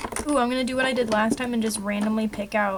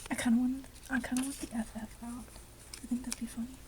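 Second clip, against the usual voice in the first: whispered, softer playful aside; mischievous whisper; 10 dB quieter, faster than usual. Real, not acted. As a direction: share a naughty idea under your breath. Mischievous aside while crafting.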